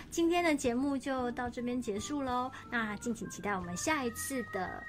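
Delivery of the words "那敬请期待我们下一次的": usual speaking voice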